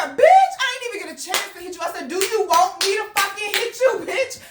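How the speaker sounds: very high, loud squawk of protest; full-tilt acted shouting match; outraged yelling. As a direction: explode at the accuser, voice shooting up. Acted quarrel in the office.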